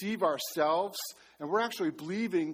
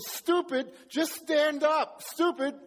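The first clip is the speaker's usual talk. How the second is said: exasperated yell; 9 semitones higher, 3 dB louder, slower than usual. Retold or imitated. Friend yelling from canoe.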